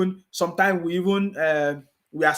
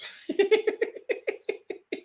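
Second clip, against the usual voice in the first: high, breathy scornful laugh; derisive laugh; 5 dB quieter than usual. Real, not acted. Streamer laughing at critics after insult, high scornful laugh.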